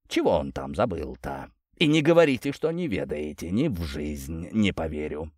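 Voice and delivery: lightly voiced old prince, low and hushed at the ends; inquisitive old prince. Prince prying news from the captain, lightly voiced and insistent.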